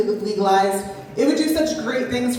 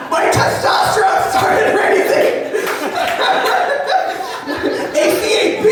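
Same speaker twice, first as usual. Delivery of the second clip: laughing; 9 semitones higher than usual. Real, not acted. Laughing at her deep cop voice.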